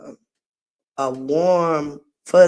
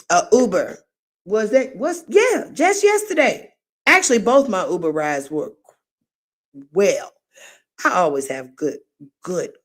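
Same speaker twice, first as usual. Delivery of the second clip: ordinary delivery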